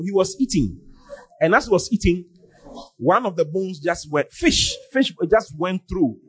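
ordinary delivery

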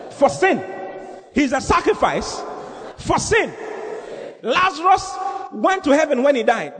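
high-pitched, emphatic pulpit delivery; impassioned preaching. Real, not acted. Preacher warns congregation they needn't go to hell, impassioned. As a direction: proclaim it high, driving each phrase home.

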